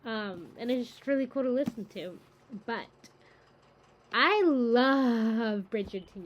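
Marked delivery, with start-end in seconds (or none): 4.1-6.2 loud, long drawn-out LOVE; real fan excitement, slow and emphatic; gushing enthusiasm. Real, not acted. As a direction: gush it loudly, stretching the words out. Gushing about her favourite show.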